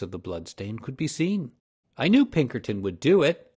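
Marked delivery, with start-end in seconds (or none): 2.0-3.5 loud, triumphant crow; triumphant exclamation. A narrator's reading. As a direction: gloat at the family, full voice. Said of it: "he exclaimed triumphantly".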